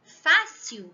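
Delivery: fácil with the final L said as U, fasiu; fácil, said Rio style; Rio pronunciation. Shown as a demonstration. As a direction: carioca fácil ending in a U sound. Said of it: "a gente tem o costume de falar a palavra".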